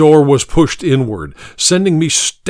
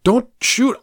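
frightened plea; 12 semitones higher than usual. A narrator's reading. Pleading at gunpoint.

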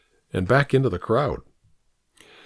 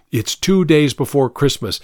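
plain reading voice

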